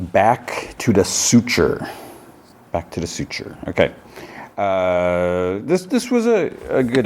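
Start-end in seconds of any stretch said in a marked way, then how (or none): 0.1-1.9 episode title read out slowly, word by word; slow title announcement. Real, not acted. 2.7-3.6 title repeated much softer, as an aside; quiet repeat. Real, not acted.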